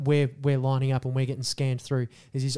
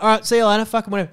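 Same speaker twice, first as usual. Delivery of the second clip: dismissive shopkeeper; 5 semitones higher, 6 dB louder, much faster than usual. Retold or imitated. Shopkeeper voiced in the story.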